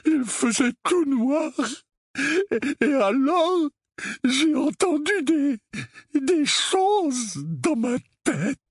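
high, halting, tearful dread; hoarse, shivering. A narrator's reading. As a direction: stammer it slowly, shuddering with fright. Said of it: "d'une voix rauque, le corps parcouru de frissons". (voix tremblante de frayeur)

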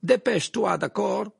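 high, loud, urgent shout; called out. A narrator's reading. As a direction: call after someone to hurry. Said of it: "cria Krum".

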